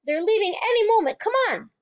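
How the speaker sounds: very high, loud urgent call; shouting. Acted. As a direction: urge her to hurry. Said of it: "she shouted".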